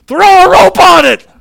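very high, full-force shout; urgent retold yell. Friend on the ground to roper, urgent yell. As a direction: imitate a desperate friend yelling orders. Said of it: "his first reaction was".

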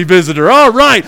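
normal talking voice

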